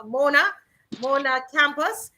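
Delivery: ordinary talk, nothing marked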